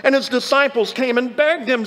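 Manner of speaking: ordinary talk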